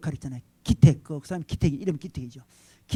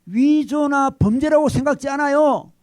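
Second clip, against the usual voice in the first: earnest son; 8 semitones higher, 5 dB louder than usual. Retold or imitated. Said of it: "그때 아들이 이렇게 이야기합니다".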